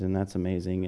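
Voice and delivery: low, soft, unimpressed throwaway; mock indifference. Churchgoer reacting to someone saved, mock indifference. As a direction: say it with all the excitement gone. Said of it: "we often lose our excitement".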